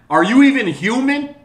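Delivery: high, loud outraged yelling; angry shouting rant. Real, not acted. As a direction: let the fury rip, loud and high. Ranting, shouting at politicians.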